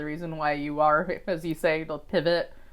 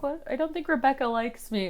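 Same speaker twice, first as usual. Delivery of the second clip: higher, anxious self-quote; worried; 7 semitones higher, 5 dB quieter than usual. Retold or imitated. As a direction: ask it nervously and higher. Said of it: "it's like".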